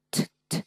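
bare voiceless "t" puffs, no vowel; aspirated T. Shown as a demonstration. Teacher showing the tongue release, voiceless aspirated T. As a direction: tap out "t, t, t" as crisp bursts of air. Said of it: "Đấy, đánh nè".

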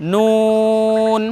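নুন with a long held oo, lips rounded; rounded-lip noon. Shown as a demonstration. Teacher demonstrates the letter noon, long rounded নুন. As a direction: stretch নুন slowly into a final n. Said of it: "ঠোঁট গোল হবে".

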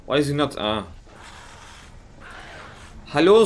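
ordinary delivery

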